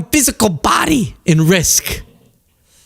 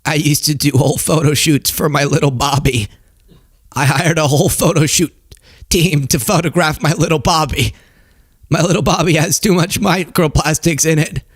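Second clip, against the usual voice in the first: strained, croaking confession parody; Bobby; 3 semitones lower than usual. Retold or imitated. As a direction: croak it out in a broken, effortful voice. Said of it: "then you would have Bobby".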